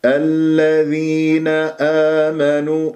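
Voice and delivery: slow, measured tajweed recitation; Quran recitation demo. Shown as a demonstration. Teacher models a Quran verse for students, slow recitation. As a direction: stretch the long vowels evenly. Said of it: "مد طبيعي حركتين".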